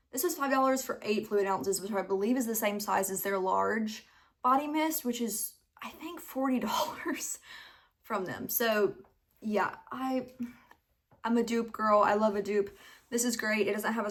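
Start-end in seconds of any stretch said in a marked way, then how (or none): 5.0-8.5 soft unvoiced aside; whispering. Real, not acted. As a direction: whisper the price like a secret.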